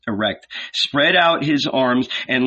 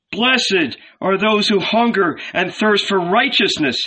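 shouting; 9 semitones higher than usual. A narrator's reading. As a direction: shout the blessing out. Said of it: "he now shouted".

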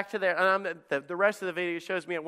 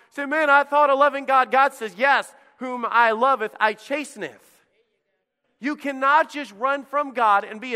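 louder, higher preaching voice; full-force preaching; 7 semitones higher, 7 dB louder than usual. Acted. Pastor to congregation warning of storms, fervent preaching.

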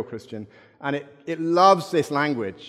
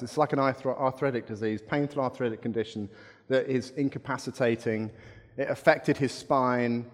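usual speaking voice

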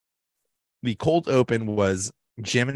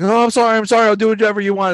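much higher and louder panicked apology; mock groveling Ryan; 11 semitones higher, 7 dB louder, much faster than usual. Ryan apologizing to Jim about the closet office, high and panicked. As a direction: beg like a caught slacker, high and loud. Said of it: "Ryan's like".

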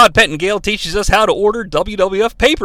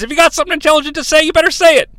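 higher, fast, pushing challenge; brash challenge; 9 semitones higher, much faster than usual. Retold or imitated. Gruff host to a silent caller, a higher fast dare. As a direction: dare him to speak up quickly. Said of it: "And Cornette says".

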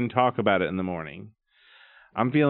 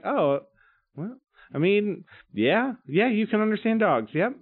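higher, drawn-out mock agreement; sarcastic agreement; 10 semitones higher than usual. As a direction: humour him with a sarcastic yep. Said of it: "I'm like".

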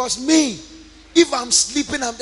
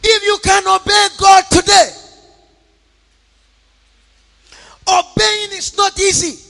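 preaching; 8 semitones higher, 6 dB louder than usual. Acted. Preaching to the congregation.

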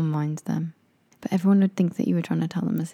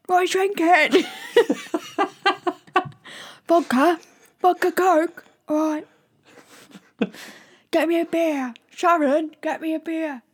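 shouting over music; 11 semitones higher than usual. Pretend. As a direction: yell as if music drowns you. Shouting party orders.

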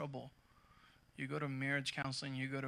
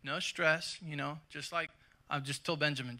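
usual speaking voice

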